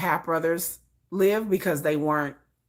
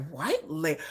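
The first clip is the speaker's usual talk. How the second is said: puzzled echo; 7 dB quieter than usual. Retold or imitated. Child repeats the kids' words back, quietly puzzled.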